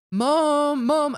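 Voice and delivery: high, loud call to mum. Retold or imitated. Son calling his mum into his bedroom, loud high call. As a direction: call her in from the next room.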